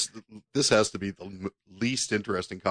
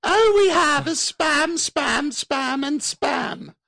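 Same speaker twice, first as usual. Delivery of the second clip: very high, slow falsetto screech; hammed-up falsetto, each word drawn out; Monty Python pepper pot; 6 dB louder, much slower than usual. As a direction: do a shrieking British housewife from a sketch. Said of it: "like when Monty Python, you know, they do the, the pepper pots".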